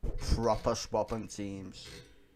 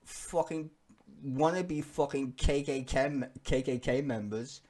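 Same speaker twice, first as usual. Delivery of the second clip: normal talking voice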